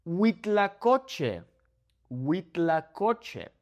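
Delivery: Huitlacoche said very slowly, wee-tla-KO-che, each vowel pure; slow clear Huitlacoche; careful Spanish pronunciation. Shown as a demonstration. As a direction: spell out Huitlacoche syllable by syllable, slow. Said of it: "here's a tricky one".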